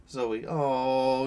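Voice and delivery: ordinary talk, nothing marked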